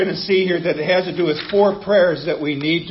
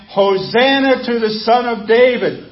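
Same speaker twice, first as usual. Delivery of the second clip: raised, louder joyful acclaim; raised voice, as a cheering crowd; crowd shouting; 4 semitones higher, 4 dB louder than usual. Retold or imitated. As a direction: call it out like the crowd. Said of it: "they were shouting in verse nine".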